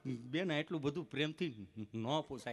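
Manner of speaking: low, slow, halting stammer; imitating a tongue-tied patient; stammering friend. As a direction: get stuck before the words come. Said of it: "જીભ જલાય, ચોંટે અટકે".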